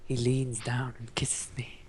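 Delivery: slow, soft creepy whisper. Pretend. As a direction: whisper it like a stalker. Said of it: "You sound like a creep".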